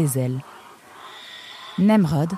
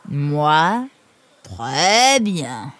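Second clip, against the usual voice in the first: lumbering character voice; Jean Mufle; much slower than usual. Acted. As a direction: answer as a big lumbering brute. Jean Mufle répond, voix de personnage. (voix de gros lourdaud)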